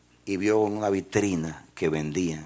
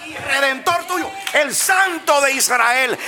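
much higher and louder, slow fervent preaching; preaching at full force; 11 dB louder, slower than usual. Acted. Preacher proclaiming God's words to the congregation, fervent shout. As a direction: proclaim God's word to the church.

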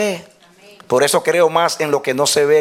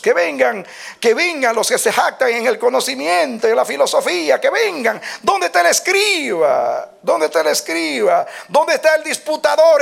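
high, relentless volley of rhetorical challenges; impassioned sermon cry, building to a long final call; challenging preaching; 9 semitones higher than usual. Acted. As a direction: summon the wise like daring opponents. Preacher challenges the wise at full force.

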